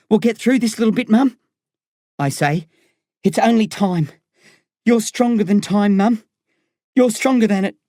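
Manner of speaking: lightly voiced boy, earnest; a son comforting his mum as he leaves; young boy consoling. A narrator's reading. Boy saying goodbye to mother.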